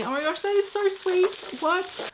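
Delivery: thrilled, touched high exclamation; delighted squeal. Real, not acted. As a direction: squeal with real delight at the gift. Sees gift from her SM.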